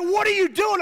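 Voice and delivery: high, loud alarmed cry; freaked out. Retold or imitated. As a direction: imitate a panicked cousin yelling. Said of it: "'cause he is freaked out".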